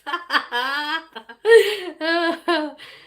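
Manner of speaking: big high laugh bursting out; loud burst of laughter. Real, not acted. Laughing loudly at a viewer's cheeky comment. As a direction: laugh out loud at the chat's joke. Reacts to married for citizenship.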